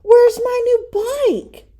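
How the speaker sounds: put-on high, loud and slow, a changed boyish voice; alarmed question. Brother asking where his brand new bike went, high alarmed cry. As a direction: demand it in disbelief.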